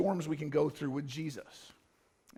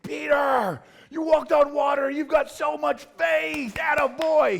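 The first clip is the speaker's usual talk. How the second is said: high, loud enthusiastic shout of praise; proud cheer; 10 semitones higher, 9 dB louder, slower than usual. Retold or imitated. God cheers Peter for walking on water, shouting high and loud. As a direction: cheer him on like a proud coach. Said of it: "He's like".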